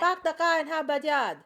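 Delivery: Boston attempt that came out Vietnamese, high and loud; hammed-up Vietnamese-sounding Boston accent; mock Vietnamese accent. Shown as a demonstration. Demoing her failed Boston accent for a friend, mock Vietnamese accent. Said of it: "How did you do that?".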